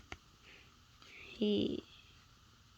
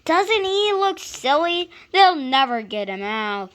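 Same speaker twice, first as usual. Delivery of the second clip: mocking children; 6 semitones higher, 11 dB louder than usual. Boys mocking.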